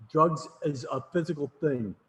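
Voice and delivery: slow, deliberate mock affirmation; mirror mantra. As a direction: recite it slowly like a self-reminder. Dictated mirror affirmation acted out.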